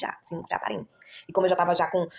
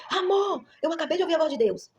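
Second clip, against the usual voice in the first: much higher, thrilled call across the house; excited shout; 9 semitones higher than usual. Retold or imitated. Wife calling her husband with amazing news, high excited shout. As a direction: call out to him, bursting with the news. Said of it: "Aí eu gritei".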